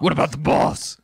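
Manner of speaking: voiced like a game hero; hammed-up hero line; character voice. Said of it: "There's voice acting. You don't need to do that".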